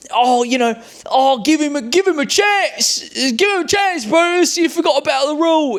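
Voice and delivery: pleading woman. Retold or imitated. Pirate woman urging Bruce to give him a chance, high pleading. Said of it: "the girl there's like".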